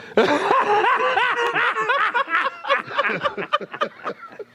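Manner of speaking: high cackling laugh. Real, not acted. Laughs at her own bit.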